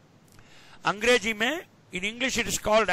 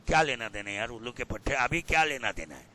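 low, quick, softened put-down; mock dismissal; 10 semitones lower, 4 dB quieter, much faster than usual. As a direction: brush off a boaster under your voice. Dismisses the boaster he just imitated. (धीमी आवाज़ में झिड़की)

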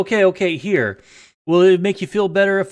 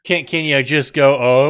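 normal talking voice

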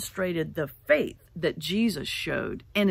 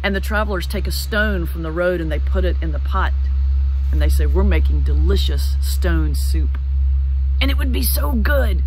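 louder, booming pitch to the whole village; loud announcing; 10 dB louder than usual. Acted. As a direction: call out so every window hears. Travelers hawking soup to village.

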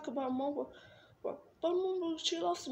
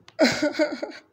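loud burst of sobbing; crying; 8 dB louder than usual. Real, not acted. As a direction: break into loud sobs. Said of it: "I'm crying because".

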